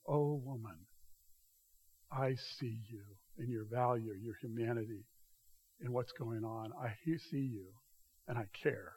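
soft, hushed and caring; tender affirmation. Acted. Jesus tenderly affirming the woman's worth, soft and caring. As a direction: gently tell her she is truly seen.

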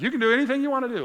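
high, loud, fast mock cheerleader; mocking the world's slogan. Preacher mocking a narcissistic world's promise, hyped and loud. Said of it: "a narcissistic kind of world that says".

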